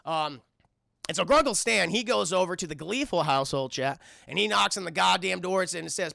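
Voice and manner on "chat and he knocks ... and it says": ordinary delivery, nothing marked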